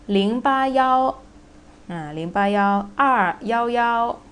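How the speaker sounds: yao for the digit one; number reading with yao. Shown as a demonstration. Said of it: "we pronounce it as yao".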